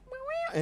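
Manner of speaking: very high imitated meow; cat meow. Imitating the cat asking for food, high meowing. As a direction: squeal out little cat meows. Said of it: "She's like".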